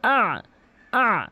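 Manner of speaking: আ squeezed from mid-throat; throat ayn drill. Shown as a demonstration. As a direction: model each আ from the middle of the throat. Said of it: "আমার সাথে practice করবেন". (আ হলকের মাঝখান থেকে)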